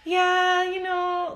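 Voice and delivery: modest brush-off. Retold or imitated. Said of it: "I used to be like".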